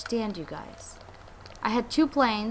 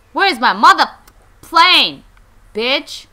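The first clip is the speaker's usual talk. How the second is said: loud, broken-up furious demand; angry shouting; 4 semitones higher, 14 dB louder, slower than usual. Pretend. Player demanding a stolen plane, angry shouting. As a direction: yell it, punching each chunk.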